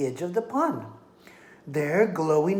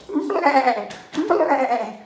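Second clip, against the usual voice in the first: high bleating lamb cry; 10 semitones higher, 6 dB louder than usual. Acted. Lamb bleats to the coyote, high bleating. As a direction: bleat like a frightened little lamb. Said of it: "Borreguita bleated".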